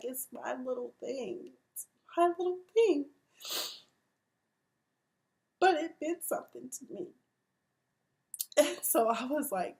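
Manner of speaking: high, halting, tearful voice; real emotion welling up mid-sentence; choked up. Real, not acted. Vlogger retelling a scene that hit home, choked up. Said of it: "I didn't mean to get emotional".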